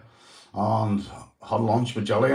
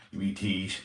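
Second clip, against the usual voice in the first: playful teasing; 4 semitones lower, 8 dB quieter than usual. Real, not acted. Replying to Lady J's teasing comment.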